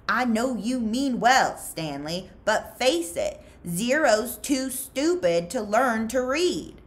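a smug grown-up explaining the obvious; condescending counselor. Acted. Counselor Pendanski to Stanley about Zero, slow and condescending.

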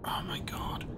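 hushed, breathy aside; real whisper under the breath; whispered dismay. Real, not acted. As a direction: drop to a whisper mid-reading. Aside while starting the letter.

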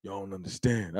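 low, soft imitation of a trailer voice; deep voiceover voice. Imitating a friend's deep movie-trailer voice, hushed. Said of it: "it's deep off the humble".

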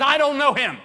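high, loud frantic denial; panicked denial. Acted. Peter denying Jesus to the girl, loud frantic blurt. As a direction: blurt it out in fear.

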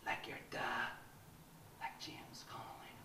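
hushed urgent self-exhortation; intense whisper. Acted. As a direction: whisper to steel yourself.

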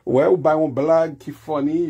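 usual speaking voice

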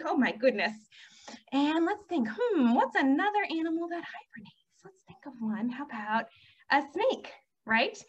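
usual speaking voice

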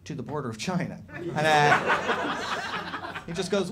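ordinary delivery

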